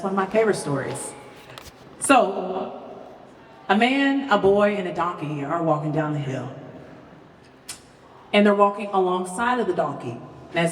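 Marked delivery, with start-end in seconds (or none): none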